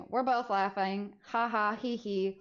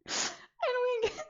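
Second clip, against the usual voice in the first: laughing; slower than usual. Real, not acted. Laughing through the story.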